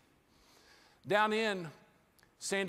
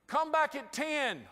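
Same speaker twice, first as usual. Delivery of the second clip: dismissive clerk; 6 semitones higher, slower than usual. Retold or imitated. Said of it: "He looked right at me and he said".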